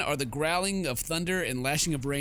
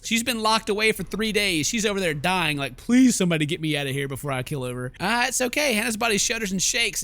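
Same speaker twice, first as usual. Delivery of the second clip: excited riffing; 4 semitones higher, 6 dB louder than usual. Real, not acted. Gamer riffing on trapped maid.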